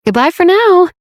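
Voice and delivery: sing-song goodbye. Acted. Cheerful phone goodbye.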